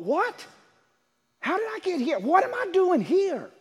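high, startled self-questioning; bewildered. Acted. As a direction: wake up confused, asking where you are. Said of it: "snapped out of it and thought".

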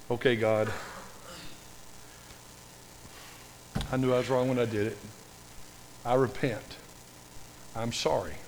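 low, subdued confession to God; quietly acted repentance; penitent prayer. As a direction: pray it humbly, with long pauses. Voices a wished-for prayer of repentance.

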